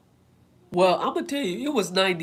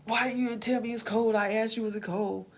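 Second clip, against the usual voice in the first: mock complaint; 6 semitones higher than usual. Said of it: "I'll be like".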